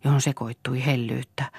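ordinary delivery

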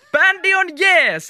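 high, loud, drawn-out shout; cheering slogan. A narrator's reading. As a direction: belt it out like a slogan. Shouted band slogan closing the story. (riemukas huudahdus)

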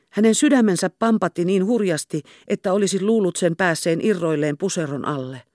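usual speaking voice

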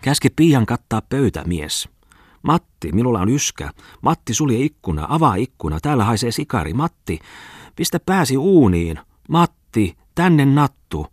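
high-pitched, commanding wife; bossy wife. A narrator's reading. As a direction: order the husband about in quick demands. (komenteleva vaimo)

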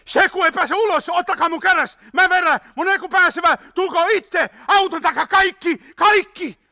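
high, loud urgent orders; shouting. A narrator's reading. Hietanen ordering everyone out behind the car, shouting. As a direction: bellow commands over the chaos. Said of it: "hapuli takaisin auton etuosaan huutaen".

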